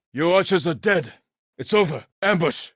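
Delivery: urgent battlefield call; tense shout. Acted. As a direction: bark it over the fighting. Fighting in a tense standoff.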